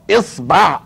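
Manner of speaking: "إصبع" with i then a, isbaʕ; pronunciation variant. Shown as a demonstration. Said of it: "يا تفتحها يا تكسرها يا تضمها".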